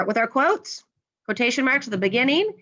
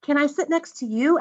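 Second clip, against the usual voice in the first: raised-pitch question; asking a question; 6 semitones higher than usual. Acted. Wendy asking to sit next to someone, asking. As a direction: ask it in a higher voice. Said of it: "asked Wendy".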